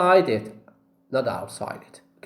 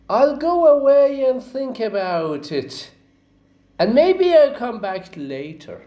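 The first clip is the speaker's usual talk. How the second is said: polite shopper; 9 semitones higher, 4 dB louder than usual. Acted.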